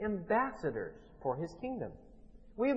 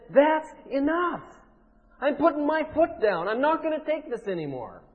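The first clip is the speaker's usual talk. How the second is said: loud, high, emphatic outburst; fed-up shout of defiance; 9 semitones higher, 7 dB louder than usual. Acted. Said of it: "gets sick and tired of a certain issue".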